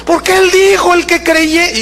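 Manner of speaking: high startled yelp of a reply. Retold or imitated. The frightened young man blurts to the pastor, high and startled. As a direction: squeak it out as if scared. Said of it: "él se asustó y dijo".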